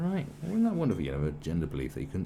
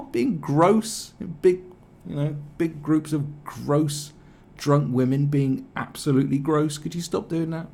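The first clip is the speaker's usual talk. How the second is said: usual speaking voice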